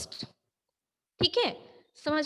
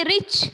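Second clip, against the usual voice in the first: रिच said in a single raised beat; one syllable; 5 semitones higher, 4 dB louder, slower than usual. Shown as a demonstration. Teacher showing a one-syllable word, रिच in one sound. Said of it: "एक ही आवाज में उस शब्द को बोलोगे".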